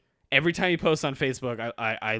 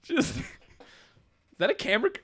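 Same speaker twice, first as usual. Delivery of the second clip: laughing; 9 semitones higher, faster than usual. Pretend. Repeats the mock line, laughing.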